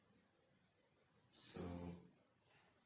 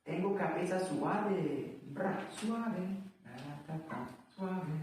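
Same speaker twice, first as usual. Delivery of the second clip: hammed-up Spanish, high and loud; mock Spanish; 12 semitones higher, 4 dB louder than usual. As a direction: play a goofy Spanish singer. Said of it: "like suave in Spanish".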